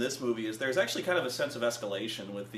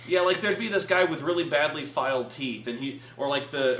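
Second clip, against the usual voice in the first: ordinary delivery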